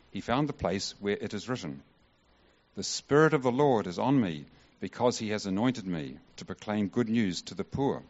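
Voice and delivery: usual speaking voice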